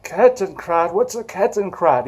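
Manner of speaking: lightly imitated puzzled old man; old veteran's voice. Old veteran puzzled by the collector's word, imitated voice. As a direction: play an old veteran querying an unfamiliar word. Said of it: "his old voice, his accent".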